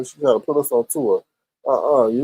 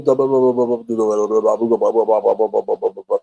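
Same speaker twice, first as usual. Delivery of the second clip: mock stuttering; 5 semitones lower than usual. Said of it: "I be stuttering".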